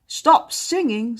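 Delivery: loud, slow irritated order; loud command. Acted. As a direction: shut the bird up firmly. Fred snaps at the bird.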